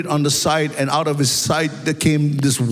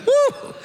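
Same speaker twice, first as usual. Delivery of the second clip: exultant woo. Real, not acted. Preacher's exultant shout.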